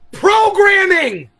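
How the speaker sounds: slow, booming reveal of the answer; dramatic shout. Real, not acted. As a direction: shout the punchline like a revelation. Big reveal after "are you ready.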